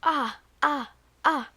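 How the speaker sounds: startled cry. Real, not acted. Game fail in a video.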